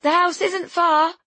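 high, drawn-out call; yelling. A narrator's reading. Friend running ahead through rain, yelling back. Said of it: "she yells".

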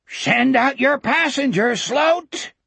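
high, taunting demand; high-pitched monster call; howling creature. Acted. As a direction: howl it from outside like a fiend. Creatures outside demand their prisoner.

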